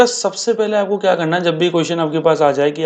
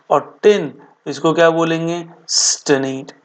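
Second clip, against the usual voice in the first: usual speaking voice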